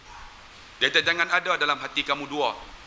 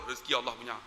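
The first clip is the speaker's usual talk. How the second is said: ordinary talk, nothing marked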